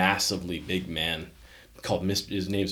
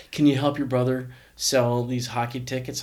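lightly voiced mom, a little higher; mom asking a favour; 5 semitones higher, 4 dB louder than usual. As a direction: ask like a mother nudging her son to help out.

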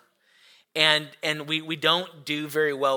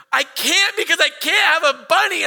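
high, loud wailing protest; hammed-up tearful tantrum; mock hysterical child; 13 semitones higher, 7 dB louder than usual. Crying daughter answering dad about the parade, mock hysterical wail. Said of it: "she starts hysterically crying".

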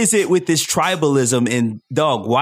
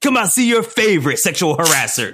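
monster truck ad; 5 semitones higher, faster than usual. Pretend. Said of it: "Sounds like a monster truck rally".

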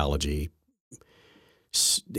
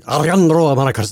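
Alejandro Mayorkas with rolled r, showy Spanish sounds; mock Spanish pronunciation; 9 semitones higher, 5 dB louder than usual. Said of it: "as the news media people like to say".

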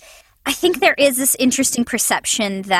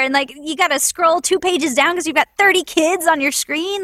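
usual speaking voice

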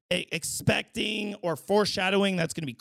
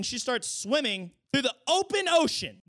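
normal talking voice

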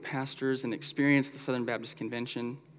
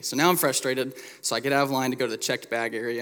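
ordinary delivery

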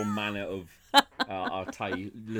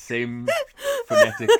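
laughing; 9 dB louder than usual. Real, not acted. Laughing at identical Chinese.

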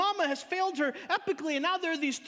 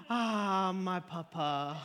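long sigh of "ah", then slow and low; relieved little girl; 6 semitones lower, much slower than usual. Retold or imitated. Toddler sees her lost papa held up, slow relieved sigh. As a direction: melt into it, drawn out and falling. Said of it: "and she goes".

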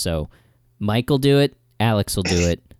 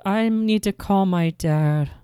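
slow, higher put-on child voice; young child; 6 semitones higher, slower than usual. Child to the adult at the door, childlike. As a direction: play a worried little kid. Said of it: "if you're two young children".

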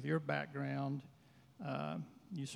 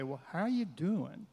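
sincere tone; 3 semitones higher than usual. Shown as a demonstration. Showing a greeting that truly asks, sincere and unhurried. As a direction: ask as if you want the answer. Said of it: "there's a tone of voice that can say".

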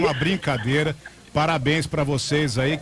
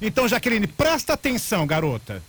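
higher, bossy put-on scold; mock scolding; 5 semitones higher than usual. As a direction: talk down to her as a fake angry customer. Prank caller scolding attendant.

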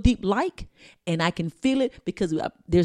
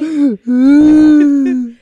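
loud wailing laugh; laugh-cry; 4 semitones higher, 15 dB louder than usual. Real, not acted. Sister still cracking up over the mock crying, loud laugh.

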